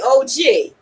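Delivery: ordinary delivery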